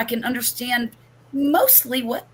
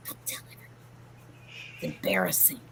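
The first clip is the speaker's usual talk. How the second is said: soft unvoiced whisper, lower at the end; 14 semitones lower, 15 dB quieter, faster than usual. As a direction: confide it under your breath. Hushed secret confession.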